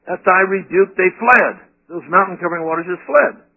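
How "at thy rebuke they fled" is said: scripture reading. Acted. Reading a Bible verse aloud.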